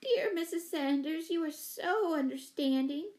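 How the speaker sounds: high, gushing thanks; emotional cry. A narrator's reading. Neighbor thanking mother for understanding, in a high cry. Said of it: "cried Miss Giddings".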